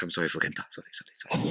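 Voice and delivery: bow and apologize softly under your breath; apologetic Daiki imitation. Imitating Daiki apologizing for Kenta, hushed and sorry.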